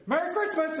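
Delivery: beam it out the drive-thru window; cheery greeting. Retold or imitated. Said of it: "I said".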